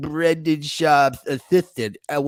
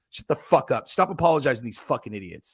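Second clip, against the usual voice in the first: usual speaking voice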